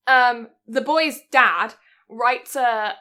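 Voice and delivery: ordinary talk, nothing marked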